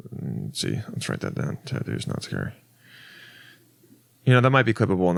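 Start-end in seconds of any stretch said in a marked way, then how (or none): 0.1-2.5 very low, hushed self-talk; talking to himself. Real, not acted. Comedian noting down a bit idea for himself, hushed mumbling. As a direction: murmur it under your breath, barely voiced.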